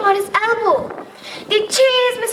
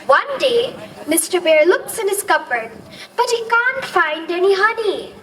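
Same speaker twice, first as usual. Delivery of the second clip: usual speaking voice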